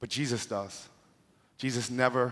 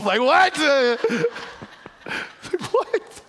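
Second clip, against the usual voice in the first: disbelief; 7 dB louder, much slower than usual. Real, not acted. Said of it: "disbelief at the Bible line".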